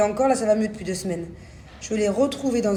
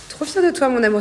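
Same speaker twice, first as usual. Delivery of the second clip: proud praise; 4 semitones higher, 6 dB louder, faster than usual. Real, not acted. Praising her son.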